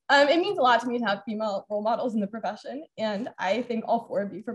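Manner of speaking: choked up but pushing through; real tearful emotion held back with laughs; emotional. Real, not acted. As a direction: voice catching with emotion, nervous laughs. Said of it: "I don't know why I'm so emotional right now".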